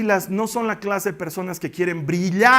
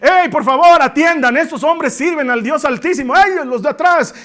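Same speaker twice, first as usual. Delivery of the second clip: loud high calling out to the crowd; shouting; 6 semitones higher, 6 dB louder than usual. Acted. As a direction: call out to passers-by at full voice.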